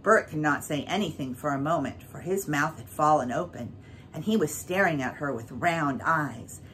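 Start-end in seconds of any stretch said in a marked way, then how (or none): none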